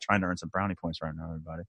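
dropped low and soft as a confiding aside; quiet aside. Real, not acted. Host confiding to listeners about flattering his guest, hushed aside.

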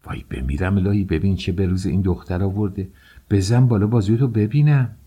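acted motherly dismay, rising; horrified dismay. A narrator's reading. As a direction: lament the sight, then urgently demand to look. Nanny horrified at the girl's bruises.

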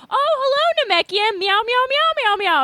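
high mock meowing greeting; fake foreign language. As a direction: meow it out in a high sing-song. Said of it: "teach this bitch a fake language".

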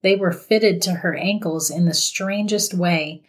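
ordinary delivery

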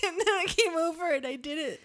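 normal talking voice

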